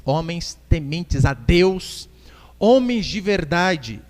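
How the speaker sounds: very slow, emphatic scripture reading; leitura bíblica pausada. Real, not acted. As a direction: draw out each quality in the verse. Preacher reads scripture aloud. (leitura bem pausada do versículo)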